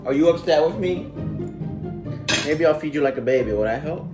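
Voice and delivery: ordinary talk, nothing marked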